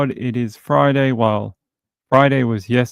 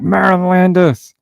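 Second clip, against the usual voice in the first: mock road-rage yell; 8 semitones higher, 4 dB louder than usual. Driver yelling the name at someone who cut them off, mock road-rage shout. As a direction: shout the name higher and louder, like a curse. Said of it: "and just yell at people".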